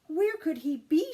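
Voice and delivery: a fretting mother asking the air; worried wondering. A narrator's reading. Mother fretting over her missing son, anxious. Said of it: "she wondered".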